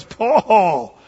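loud, slow outburst at Paul. Acted. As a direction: shout it at him, accusing. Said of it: "Festus said with a loud voice".

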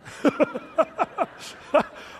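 high, breathy burst of giggles; real high-pitched chuckling; laughing. Real, not acted. Preacher cracking up at the demon story, real laughter.

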